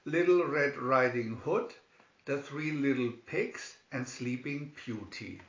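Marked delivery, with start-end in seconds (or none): none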